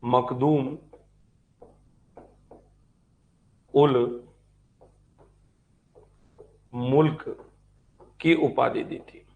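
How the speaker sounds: slow dictation, long pauses between each chunk; teacher dictating to a class, pausing for pens; dictating notes. Shown as a demonstration. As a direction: read it out phrase by phrase so students can write.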